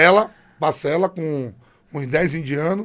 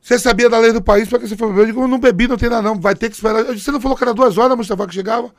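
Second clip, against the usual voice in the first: louder, higher exasperated quarrel; heated argument; 6 semitones higher, 6 dB louder, faster than usual. Retold or imitated. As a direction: argue it out hot. Discussão acalorada na cela. (discussão irritada com o tradutor)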